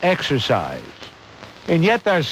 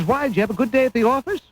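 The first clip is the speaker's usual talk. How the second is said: higher, quick mock wife's question; mock chirpy wife; 7 semitones higher, much faster than usual. Host mocking a couple, quick chirpy wife. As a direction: rattle it off as the eager wife. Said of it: "She says".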